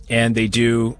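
ordinary delivery

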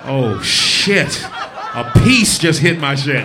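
slow, laughing shout; mock panic shout. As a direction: yell it like it just happened. Reacting to accident, shout heard.

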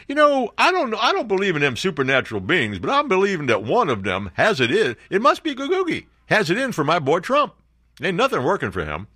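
lightly voiced folksy character. Host voicing a folksy Trump fan.

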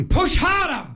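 hammed-up delivery-room yell; retold shout. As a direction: bark it like a panicked husband. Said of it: "I shouted at my wife".

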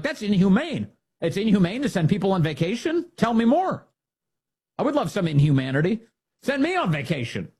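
sarcastic mocking voice; sarcastic lefty impression; mock Democrat. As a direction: mockingly play the outraged Democrat then relish it. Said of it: "All these Democrats are like".

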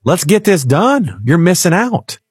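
a devil on the shoulder pushing into the market; devil duck egging on. Retold or imitated. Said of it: "He's like".

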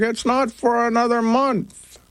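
high-pitched mock mom voice; imitating mom. Retelling mom's confused reply.

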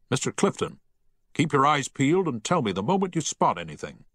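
a lightly voiced ship's captain giving orders; steady captain's command. A narrator's reading.

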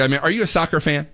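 ordinary delivery

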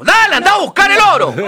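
high, loud pushy mother; greedy mother. Acted. Greedy mother sending her daughter down the well, high and loud. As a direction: shove her off with a shrill-free high loud order.

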